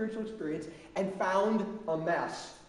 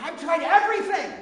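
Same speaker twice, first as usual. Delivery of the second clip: desperate father; 9 semitones higher, 8 dB louder than usual. Acted. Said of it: "can't you just feel the dad's heart here".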